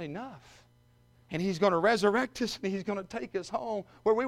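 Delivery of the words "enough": quiet. Retold or imitated. Preacher giving the Lord's word again, softly and quietly. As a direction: say Enough quietly, almost to yourself. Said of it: "He's gonna shout".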